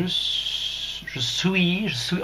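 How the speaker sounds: put-on drawn-out French in a heavy English accent; slow, put-on English accent. Streamer deciphering Jean-Daniel's message, mock English accent. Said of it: "Jean-Daniel parle avec un accent anglais".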